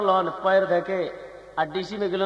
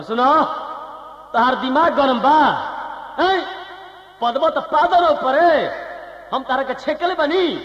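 angry shout; 9 semitones higher than usual. Acted. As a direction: yell back angrily, pitched up. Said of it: "Bihari भाई लोग गरम हो गइले".

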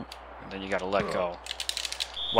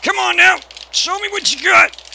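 high, loud taunting yell at the screen; taunting shout; 7 dB louder than usual. Real, not acted. Gamer yelling at his wrestler, loud taunting shout. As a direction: bellow a challenge at the opponent.

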